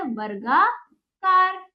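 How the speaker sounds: वर्गाकार very slowly as "var-gaa... kaar"; slow syllables. Shown as a demonstration. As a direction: sound out वर्गाकार part by part. Teacher sounds out the correct word.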